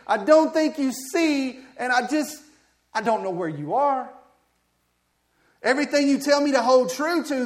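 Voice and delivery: loud, high, impassioned lament; prophet's complaint to God. Acted. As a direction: rail at God as the doubting prophet. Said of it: "he's basically saying".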